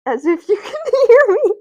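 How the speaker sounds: bursting into high, loud laughter. Real, not acted. Streamer cracking up at the viewers, laughing loudly.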